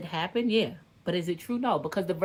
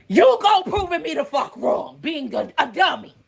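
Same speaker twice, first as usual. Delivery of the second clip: angry yell; 6 semitones higher, 6 dB louder than usual. Real, not acted. Yelling at ex.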